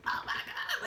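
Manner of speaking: a whisper of disbelief, barely breathed; stunned whisper. Retold or imitated. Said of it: "I was just like".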